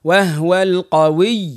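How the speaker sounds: slow وهو القوي with doubled و in وهو; slow teacher demo of the waw shaddah. Shown as a demonstration. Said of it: "قوي، لا".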